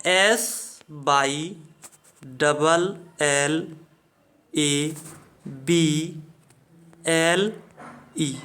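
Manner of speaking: slow letter-by-letter spelling, low pitch; measured, low-pitched spelling with gaps between letters. Shown as a demonstration. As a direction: spell SYLLABLE one letter at a time, with pauses. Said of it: "English में एक शब्द होता है syllable".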